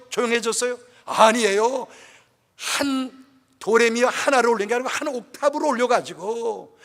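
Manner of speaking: loud, driving sermon climax; forceful preaching. Acted. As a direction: preach it out full force to the congregation. (힘차게 외치는 설교)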